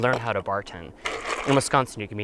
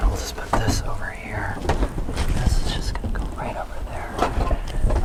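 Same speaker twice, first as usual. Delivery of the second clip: whispering. Real, not acted. Quiet placing of dishes.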